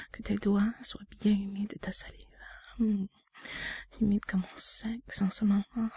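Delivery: hushed, close to the mic; intimate whisper. Acted. To her partner, guiding him in a whisper.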